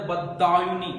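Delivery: Badayuni with दा punched loud and stretched; slow Badayuni, loud weight on its दा; stress on दा syllable. Shown as a demonstration. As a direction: hit the दा in Badayuni hard, slowly. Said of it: "दै के ऊपर बलाघात लगता है".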